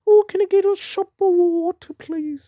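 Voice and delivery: overly sweet put-on plea; mock rugby guy. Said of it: "Comes up to me and goes".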